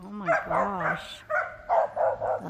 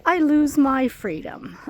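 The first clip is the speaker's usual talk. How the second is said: dog's inner voice; 6 semitones higher, 7 dB louder than usual. Retold or imitated. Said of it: "He's like".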